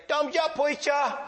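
very high, drawn-out put-on voice; imitated older man's slow high call; Herbie. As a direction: call it back slowly, high and odd. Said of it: "And he'd say".

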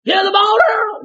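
high yell from the crowd; fans yelling. Retold or imitated. Fans shouting at the coach for Earl. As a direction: holler it from the stands.

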